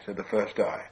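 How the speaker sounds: ordinary delivery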